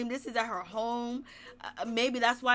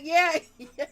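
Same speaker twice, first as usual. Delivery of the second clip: laughing yeah; 5 semitones higher, 9 dB louder than usual. Retold or imitated. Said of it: "He was like".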